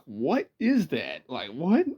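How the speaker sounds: mildly baffled, drawn-out question; baffled kid. Retold or imitated. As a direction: imitate a puzzled child, stretching the words. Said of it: "me as a kid, I'm like".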